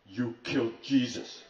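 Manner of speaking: slow, loud, weighty accusation; drawn-out, hammed-up finger-pointing; mock accusation. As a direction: hammer out each word as a retold charge. Retold accusation by a friend.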